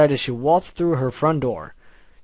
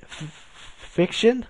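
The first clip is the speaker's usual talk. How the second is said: dawning realization; 8 semitones higher, slower than usual. A narrator's reading. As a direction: stammer into a high question. Stinging realization of an insult.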